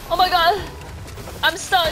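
high, loud cry of alarm; panicked shout. Real, not acted. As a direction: yell it like you can't move. Trapped in game, panicking.

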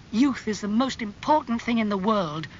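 angry. A narrator's reading. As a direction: plead against fate with mild storybook anger. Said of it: "his face red and angry".

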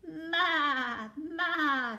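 loud, high, long "maa"; goat bleat. Acted. Reader plays the goat for children, loud bleating. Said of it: "said the goat".

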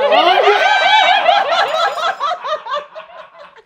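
carcajada chillona y fuerte; suéltala a gritos de risa; carcajada aguda. Real, not acted. Risas al freír en aceite. (carcajada aguda y sonora)

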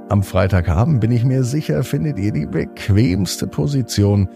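usual speaking voice

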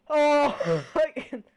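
loud, high laugh cracking the act; real laughter breaking through. Real, not acted. Streamer cracking up mid-bit, laughing loud.

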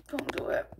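breathless whispered warning; whispering. Real, not acted. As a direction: whisper it as a strained plea.

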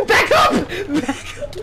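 high, laughing panic; frantic high-pitched order; panicked warning. Real, not acted. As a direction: cry out high, half laughing. Warding off danger in game.

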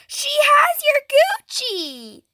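high, drawn-out cry to Mom; high-pitched, stretched-out outcry; alarmed tattling. Pretend. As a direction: wail the news as a tattletale. Tattling to Mom about sister.

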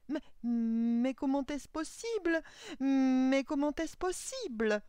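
high, loud, slow stutter; stammering astonishment. A narrator's reading. Old clockmaker watches his doll dance, loud stammered amazement. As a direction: stammer in disbelief, dragging the first sounds. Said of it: "du vieil horloger qui balbutiait".

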